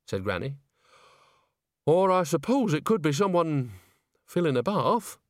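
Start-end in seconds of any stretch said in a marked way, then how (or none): 1.9-5.2 higher-pitched grudging allowance; conceding. A narrator's reading. Witch grants her friend's guess about the splashing, conceding. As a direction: allow that it might be so, lingering on "bath". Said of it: "Nanny conceded".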